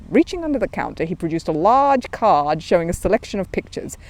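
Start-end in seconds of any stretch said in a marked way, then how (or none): none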